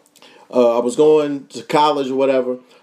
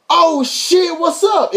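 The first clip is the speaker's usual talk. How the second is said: excited shout; 13 semitones higher, much slower than usual. Retold or imitated. Recognizing Cat Williams at the stoplight, excited shout. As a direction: yell it like you can't believe who it is.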